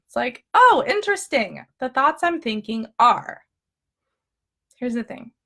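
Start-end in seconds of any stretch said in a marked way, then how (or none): 0.5-3.4 high-pitched, curious "oh"; mock discovery of a thought; pleasantly intrigued, pitched up; detached observing. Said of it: "I'm observing them".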